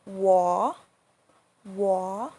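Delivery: "wo" dipping low then rising; long "wo" with a low dip and upturn; third tone. Shown as a demonstration. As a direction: scoop "wo, wo" down and back up. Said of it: "A useful example is a Chinese word".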